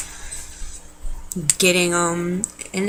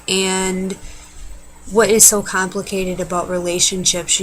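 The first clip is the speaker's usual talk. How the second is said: ordinary delivery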